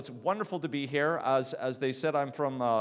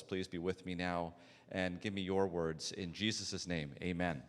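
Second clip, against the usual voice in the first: prayer; 6 semitones lower, 3 dB quieter than usual. Acted. Opening prayer before the talk.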